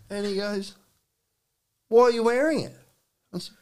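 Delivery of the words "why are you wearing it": high, louder retold question; young lad's pitched-up challenge. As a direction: imitate a lad challenging someone. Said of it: "this young lad goes".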